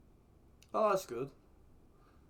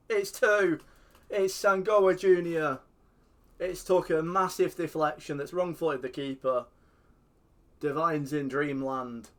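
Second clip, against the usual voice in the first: louder, higher play-by-play cry; mock commentary; 4 semitones higher, 7 dB louder than usual. Real, not acted. Describing his own goal as a TV commentator, excited.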